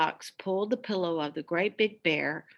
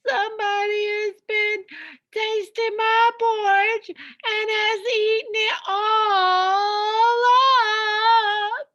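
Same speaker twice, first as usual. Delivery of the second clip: a wailing little cub who lost his porridge; little wee bear crying; 11 dB louder than usual. Acted. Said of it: "cried the little wee bear in his little wee voice".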